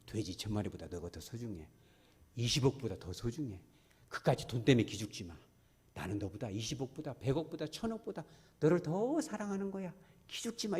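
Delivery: soft, hushed reassurance; gentle Jesus. Retold or imitated. Jesus comforting the healed man, soft and tender. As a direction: comfort him quietly like a loving master. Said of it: "이렇게 얘기하고 있는 거예요".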